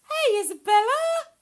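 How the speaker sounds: loud, high welcome shout; excited greeting. Real, not acted. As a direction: call out a high singsong hello. Calling out to a viewer joining.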